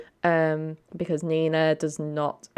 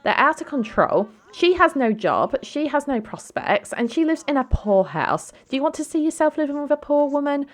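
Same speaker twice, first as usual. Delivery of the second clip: higher, louder scornful rant; disapproving sister tearing into her sibling's girlfriend, scornful and raised; snobby dismissive sister; 8 semitones higher, 4 dB louder than usual. Acted. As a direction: sneer down at the poor girlfriend, pitched up. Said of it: "And Dina's like".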